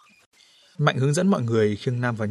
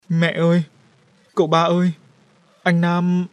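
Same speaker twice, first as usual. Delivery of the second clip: tearful cry out; 6 semitones higher, 5 dB louder than usual. A narrator's reading. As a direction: cry out for the family, higher and louder. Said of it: "Nó kêu rú lên ... vừa khóc". (kêu rú lên, vừa khóc)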